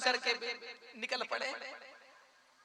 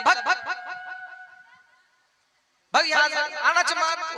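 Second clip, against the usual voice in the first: loud, higher shooing; mock angry; 8 semitones higher, 7 dB louder, slower than usual. Husband pretends to throw wife out, loud and higher. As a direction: drive her off with a fake yell.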